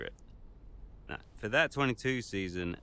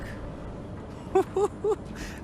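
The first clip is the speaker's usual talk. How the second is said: high laugh. Acted. Laughs on entering.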